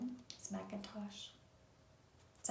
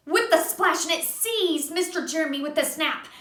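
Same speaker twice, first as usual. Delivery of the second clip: put-on gentle read-aloud for a child; reading to a little one; storybook narration; 5 semitones higher than usual. A narrator's reading. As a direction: tell it like a bedtime story. Reading a picture book aloud.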